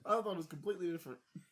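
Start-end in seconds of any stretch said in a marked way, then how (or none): 1.3-1.4 whisper. Real, not acted. Stunned aside to co-host.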